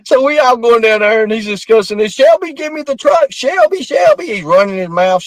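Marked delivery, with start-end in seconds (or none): 2.1-4.3 high whining repeated name; mock whiny plea. John Paul being arrested, whining at Shelby for the truck, high mock plea. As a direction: whine the name, begging.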